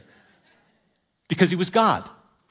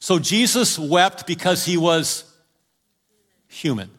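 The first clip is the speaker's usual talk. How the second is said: ordinary talk, nothing marked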